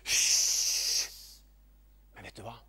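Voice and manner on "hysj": long sharp "shh"; shushing. Acted. Crowd hushing the blind man, long shush. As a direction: hush someone with a drawn-out shh. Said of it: "de begynte å hysje på han".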